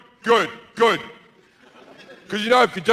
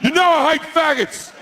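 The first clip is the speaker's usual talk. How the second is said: much higher, indignant divine rant; ranting God; 10 semitones higher than usual. Acted. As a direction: sneer it as the bigoted God.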